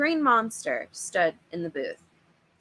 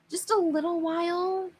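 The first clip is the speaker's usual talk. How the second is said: much higher begging voice; pleading kid; 10 semitones higher than usual. Acted. Narrator as a kid joining his brother begging Dad, high pleading. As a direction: coax it out sweetly, begging. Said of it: "I joined my brother in pleading".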